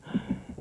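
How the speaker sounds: sudden unvoiced gasp; demonstrated gasp for breath. Shown as a demonstration. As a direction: gasp as if you forgot to breathe. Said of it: "and take a breath".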